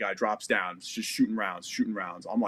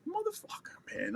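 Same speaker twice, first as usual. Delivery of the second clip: much higher, strained cry of disbelief; exasperated curse; 9 dB quieter than usual. Retold or imitated. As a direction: squeeze it out in fed-up disbelief. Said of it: "I'm like".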